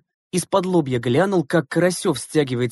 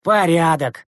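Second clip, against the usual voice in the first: raised, showy declaration; booming pronouncement; 3 semitones higher, 4 dB louder, slower than usual. A narrator's reading. Schoolboy Mukhin pronouncing on the trio, booming. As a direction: declare it for the whole bus. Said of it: "громогласно высказался Мухин".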